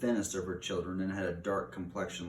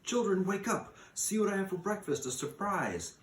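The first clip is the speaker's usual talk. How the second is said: put-on high, bright calling out to the children; cheerful call; 12 semitones higher than usual. A narrator's reading. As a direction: wake the kids with a happy surprise. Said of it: "calling cheerfully as she went".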